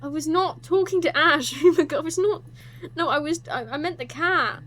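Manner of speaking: loud laughing denial; flustered protest. Real, not acted. Streamer denying she talked to Ash, loud flustered laugh. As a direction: insist loudly through embarrassed laughter.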